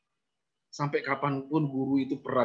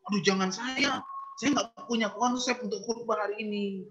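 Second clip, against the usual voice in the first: higher, nervous excuse voice; a teacher dodging the request, higher; flustered refusal; 9 semitones higher than usual. Retold or imitated. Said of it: "tiba-tiba jawabnya".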